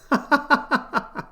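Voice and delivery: a burst of high disbelieving laughter. Real, not acted. Vlogger laughing at a huge stablecoin print, high laugh. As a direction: laugh at the absurdity. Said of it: "I'm only laughing 'cause I".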